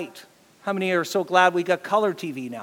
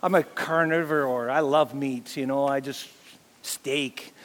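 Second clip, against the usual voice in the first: mock carnivore; 4 semitones lower, slower than usual. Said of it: "we are like".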